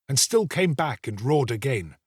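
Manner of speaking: plain reading voice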